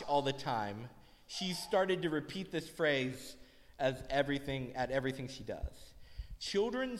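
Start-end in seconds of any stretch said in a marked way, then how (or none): none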